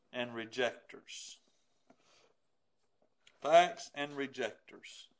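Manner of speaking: "Facts and rejectors" low, soft, slow; slow quiet heading. Acted. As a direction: let the heading sink in. Preacher announces point softly.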